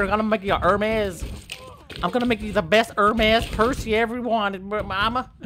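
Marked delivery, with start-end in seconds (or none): none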